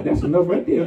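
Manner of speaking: chuckling through it; preacher amused, telling the church it is enough; laughing. Real, not acted. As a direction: laugh the words out. Preacher repeats line, first laughing.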